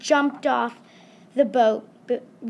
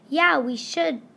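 usual speaking voice

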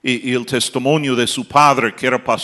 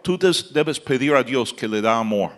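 ordinary talk

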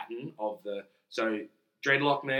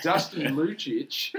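slow careful name; 5 semitones higher, 6 dB louder, much slower than usual. Retold or imitated. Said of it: "I was like".

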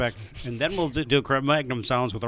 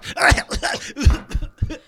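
squealing breathy laugh, pitched way up; high gagging laugh; 8 dB louder than usual. Retold or imitated. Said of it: "She goes".

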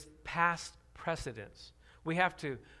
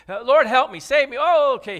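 loud, urgent plea; desperate cry; 4 semitones higher, 13 dB louder than usual. Shown as a demonstration. Preacher acts out a sinking believer crying loudly to the Lord. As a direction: cry out as you slip under.

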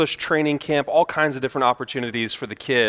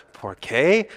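tourist's attempted Italian accent; put-on Italian accent. Visitor handing brochures to passers-by in Italy, put-on Italian accent. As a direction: try your best Italian accent on it. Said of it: "put-on Italian accent".

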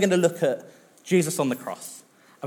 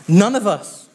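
bursting out louder and higher; loud hasty denial to the king; sudden loud protest; 6 semitones higher, 8 dB louder than usual. Acted. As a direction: blurt it out as the startled officer. Officer answers the angry king.

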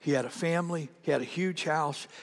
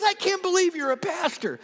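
disbelief; 13 semitones higher than usual. Retold or imitated. Brian still in disbelief.

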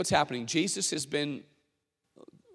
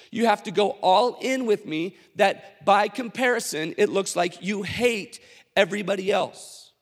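louder, pressing preaching; impassioned challenge; 4 semitones higher, 5 dB louder than usual. Acted. Jesus challenging the whole crowd, impassioned. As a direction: drive it home to everyone.

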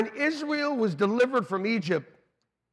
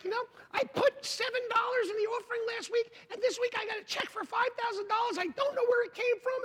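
churchgoer testimony; faster than usual. Retold or imitated. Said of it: "somebody was like".

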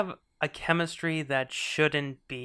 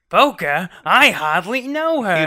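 put-on loud, slow old borscht belt comic; Catskills comedian; 4 semitones higher, 11 dB louder than usual. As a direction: punch the joke like a Catskills comic.

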